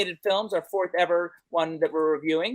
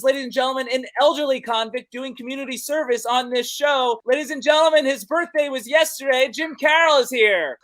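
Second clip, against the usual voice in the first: raised, louder announcer build-up; emcee introduction; 4 semitones higher, 4 dB louder than usual. Acted. Host introduces co-host Jim like an announcer. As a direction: present him like a ring announcer.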